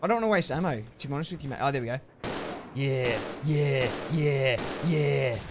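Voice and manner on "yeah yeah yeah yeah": louder, drawn-out yeahs; loud stretched-out celebration; excited yeahs. Real, not acted. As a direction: cheer it as the kill lands. Celebrating a zombie going down.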